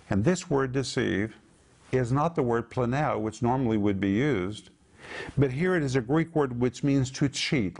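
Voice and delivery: ordinary talk, nothing marked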